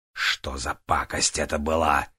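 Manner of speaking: low, slow villain question. Acted. Relos Var asks.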